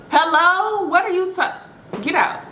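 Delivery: indignant disbelief. Retold or imitated. Kicking the man out after the reveal, high indignant disbelief. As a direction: throw him out in disbelief.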